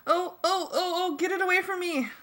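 high-pitched squealing panic; shrieky, frightened plea; panicked scream. A narrator's reading. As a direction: scream it high without great volume. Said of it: "Darlene screamed".

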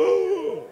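high, loud gasp through a laugh; imitating mom's high gasp as she smokes; mom's startled cough. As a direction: choke out a shocked oh after the first drag. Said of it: "Hizo como".

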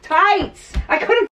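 high, loud relieved cry; excited eureka; triumphant. Real, not acted. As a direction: announce the word like a win. Finally finds the word.

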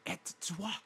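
hammed-up French question; funny French accent. Friend trying the French funny voice, French accent. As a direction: finish in a comic French voice. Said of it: "do the funny voice".

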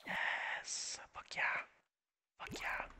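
hushed, triumphant whisper; whispered cheer. Real, not acted. Player cheering the viral speech to self, whispered triumph. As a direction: celebrate under your breath. Private cheer at in-game success.

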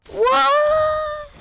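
sharp, very high squeak of surprise; startled high-pitched what; surprised. Real, not acted. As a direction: yelp it in disbelief. Sudden surprise in game.

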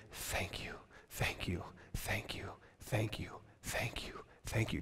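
breathless repeated whispered thank you; the healed leper at Jesus' feet whispering thanks, overwhelmed. Acted. Overwhelmed whispered gratitude.

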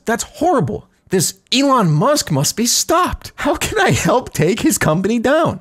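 high, slow, determined Mario; heroic Mario voice. Retold or imitated. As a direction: declare it like a hero.

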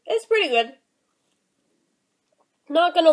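chewing while talking; biting into cookie; mouth full. Real, not acted. Seen 1: bites cookie 0.7-1.7, chews closed-mouthed until 3.0.

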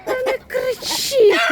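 high and slow, stretched-out telling off; scolding call. Real, not acted. Woman telling her screeching chickens to stop. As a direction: drag it out to hush the birds.

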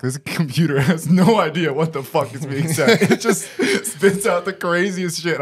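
breaking into real laughs mid-sentence; can't keep a straight face about it; laughing. Real, not acted. Host laughing at subtitles mangling his speech, laughing.

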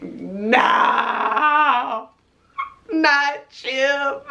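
drawn-out, whimpering plea; mock-cry it as the soul protesting; mock wail. Her soul protesting about Chip, mock wail. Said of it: "My soul was like".

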